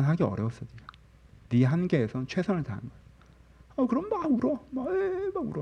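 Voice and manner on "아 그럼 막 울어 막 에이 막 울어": high-pitched imitated wail; mock crying. Preacher retelling tears during prayer, high mock wail. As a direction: act out bawling in a high voice. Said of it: "그러면서 막 울어".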